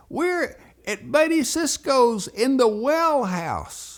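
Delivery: high, loud, drawn-out yelling; calling back. Retold or imitated. Boys tell daddy where they're trapped, hollering. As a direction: holler every word slowly so it carries.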